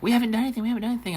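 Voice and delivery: whiny protest. Retold or imitated. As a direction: pitch it up high, whining and pleading. Drunk guests protesting innocence.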